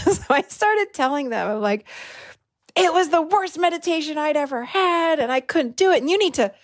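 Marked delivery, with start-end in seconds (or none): 2.8-6.5 higher-pitched, acted-out venting; ranting complaint. Complaining to staff about the snorer, ranting. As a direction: go on and on in mock outrage. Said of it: "I was going on and on and on".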